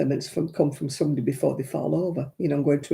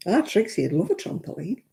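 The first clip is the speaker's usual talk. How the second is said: normal talking voice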